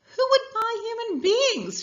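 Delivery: high, slow, laughing disbelief. Acted. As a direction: laugh the question out in scorn. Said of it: "she starts to laugh".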